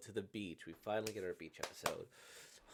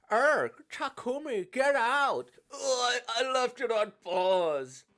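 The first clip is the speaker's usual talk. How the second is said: loud, high-pitched yelling in alarm; acted outburst of embarrassed alarm; panicked shout; 12 dB louder than usual. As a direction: yell it frantically as a flustered character. Game character panics, orders friend out.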